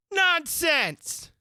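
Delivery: high, loud, firm retort; defiant girl shouting back; loud and decided. Acted. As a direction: shut her down with one loud word. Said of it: "very loudly and decidedly".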